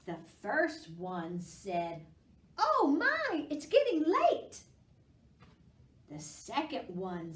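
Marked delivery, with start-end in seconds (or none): 2.6-4.6 squeaky high worried pumpkin. Acted. Teacher voicing a pumpkin for kids, high and loud. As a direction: pitch way up like a tiny pumpkin.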